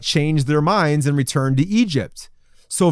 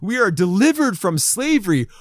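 excited; 6 semitones higher than usual. Acted. Preacher voices freed Israelites leaving Egypt, excited. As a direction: celebrate freedom in a higher, elated voice. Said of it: "They're excited".

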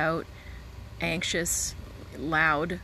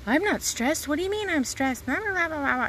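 high, loud mock snap of a defensive woman; 10 semitones higher, 4 dB louder than usual. A stressed receptionist snapping back, high and loud. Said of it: "they bark and they project".

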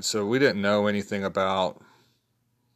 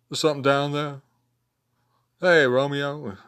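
raised, half-sung punk lyric imitation; 6 semitones higher, 4 dB louder than usual. Fan showing how a favourite singer phrases things, high imitation. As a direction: mimic a singer's inflection in a high voice. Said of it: "love his inflection and the way he phrases things".

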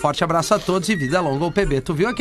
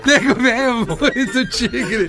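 laughing; 6 semitones higher, slower than usual. Real, not acted. Laughing after joke.

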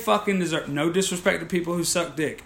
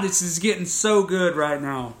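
normal talking voice

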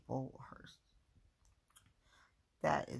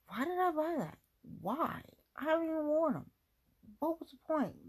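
exasperated self-scolding; 10 semitones higher, faster than usual. Real, not acted. Scolding herself over unworn boots.

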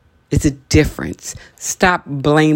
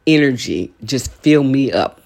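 ordinary talk

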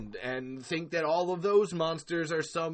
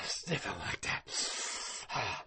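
unvoiced, slow, softer murmur; hushed aside; 8 semitones lower, 10 dB quieter, much slower than usual. Real, not acted. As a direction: drop to a breathy near-whisper while showing the sniff. Demonstrating sniffing his armpits.

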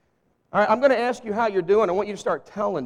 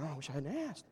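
inner thought; 6 semitones lower, 18 dB quieter, faster than usual. Retold or imitated. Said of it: "then I'm thinking".

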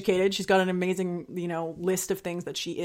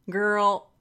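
ordinary talk

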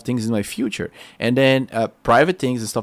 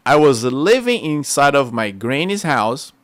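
usual speaking voice

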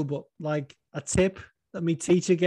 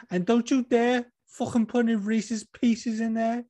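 Liverpool fighter ordering fast food, building up; Darren Till ordering; 8 semitones higher than usual. Retold or imitated. Host as Darren Till ordering at the Dairy Queen drive-thru. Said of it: "here he is at the fucking drive-thru".

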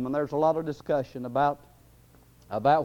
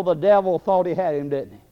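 ordinary delivery

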